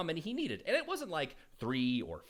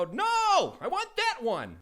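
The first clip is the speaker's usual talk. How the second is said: put-on high, louder child insisting; frustrated kid; 13 semitones higher, 5 dB louder, much slower than usual. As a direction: stamp it out like an annoyed kid. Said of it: "they go".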